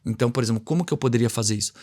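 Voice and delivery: usual speaking voice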